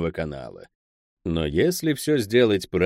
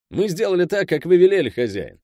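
higher-pitched underling cutting in; hasty interjection; 10 semitones higher, 5 dB louder than usual. A narrator's reading. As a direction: butt in high and eager to placate the boss. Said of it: "встрял Пэл, опасаясь, что Клод разоткровенничается".

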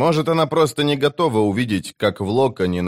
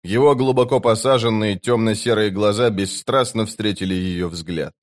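ordinary delivery

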